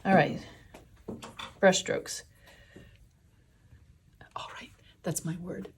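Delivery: ordinary talk, nothing marked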